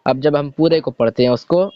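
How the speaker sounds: ordinary talk, nothing marked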